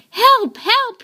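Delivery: high, urgent cry of help; calling for help. A narrator's reading. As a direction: call out for help. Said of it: "he said".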